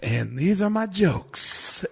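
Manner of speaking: slow, high, laid-back intro; imitating Mitch. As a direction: introduce yourself with sleepy deadpan cool. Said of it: "Mitch said".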